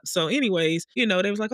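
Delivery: ordinary talk, nothing marked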